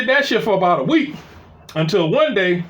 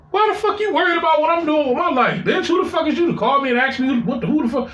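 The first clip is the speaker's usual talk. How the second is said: much higher, heated and fast; angry comeback; 7 semitones higher, faster than usual. Retold or imitated. He shouts back at his jealous ex on the phone, high and furious. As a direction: fire it back at her.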